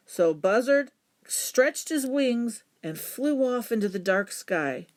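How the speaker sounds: usual speaking voice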